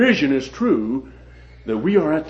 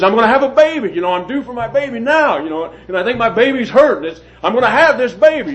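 retold frantic plea, much higher and louder; panicked wailing; 8 semitones higher, 4 dB louder than usual. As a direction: imitate the injured woman pleading for her baby. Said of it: "screaming and yelling".

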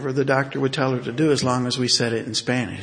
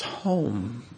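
softer, strained single word, barely getting it out; struggling to speak; 5 dB quieter than usual. Retold or imitated. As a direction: push the word out with effort. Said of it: "it was a struggle to say it".

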